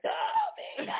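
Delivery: put-on shrieking high Spanish girl voice, shouted; Spanish girl impression. Imitating a Spanish girl calling out to a man, high shout. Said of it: "Spanish girl say".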